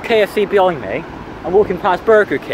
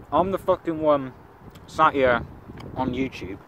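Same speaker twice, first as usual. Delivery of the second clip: mouth full; 6 dB quieter than usual. Real, not acted. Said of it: "speaking with a mouthful of fucking Macca's".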